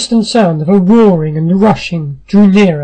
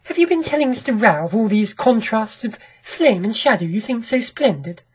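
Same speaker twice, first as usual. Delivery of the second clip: soft, higher woman's character voice; 4 semitones higher, 5 dB quieter than usual. A narrator's reading. Wife questioning her husband before the visitor, composed and soft. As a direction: lift the pitch and soften, keeping it composed. Wife speaks up to cover tension.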